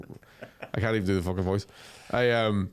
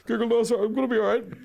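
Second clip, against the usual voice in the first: high pleading voice; 9 semitones higher than usual. Retold or imitated. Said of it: "You're like".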